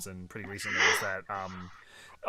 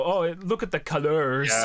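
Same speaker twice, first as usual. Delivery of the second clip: higher, louder posh colours; mock British colour; 5 semitones higher, 6 dB louder than usual. Shown as a demonstration. Mocking the U in colour, higher louder mock British. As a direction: say colors as if it had the U. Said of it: "We don't go".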